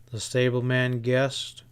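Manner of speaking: ordinary delivery, nothing marked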